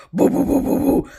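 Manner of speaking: loud, booming wordless mumble; rumbling "boo boo boo" imitation; deep man's tones. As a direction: imitate an unintelligible deep voice in the next room. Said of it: "deep tones of his voice".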